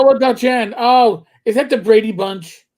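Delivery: loud, high burst of recognition; excited penny-drop outburst; sudden realization. Real, not acted. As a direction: blurt it as it clicks, high and loud.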